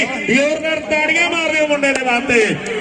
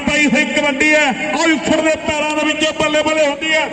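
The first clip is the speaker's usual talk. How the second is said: fast, pitched-up kabaddi announcer; frantic rural sports commentary; 5 semitones higher than usual. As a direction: keep the crowd fired up on the mic. Live kabaddi commentary over loudspeaker.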